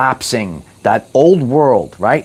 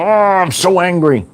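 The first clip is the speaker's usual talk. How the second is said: mock angry person; 5 semitones higher than usual. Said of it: "overly serious and angry".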